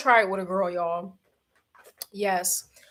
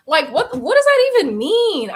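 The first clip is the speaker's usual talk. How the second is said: high, loud disbelieving question; incredulous; 7 semitones higher, 7 dB louder than usual. Real, not acted. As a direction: ask it in total disbelief.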